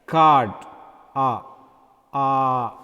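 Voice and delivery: card, then the ah alone, held; sustained ah after "card"; long ah in card. Shown as a demonstration. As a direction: draw out the ah of card, then isolate it. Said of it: "With the long vowels".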